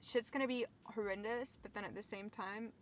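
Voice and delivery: ordinary delivery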